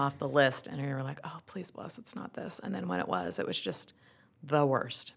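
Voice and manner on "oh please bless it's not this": very soft, half-whispered prayer; hushed plea. Retold or imitated. Wife recalling her prayer against the diagnosis, hushed plea. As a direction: pray it under your breath.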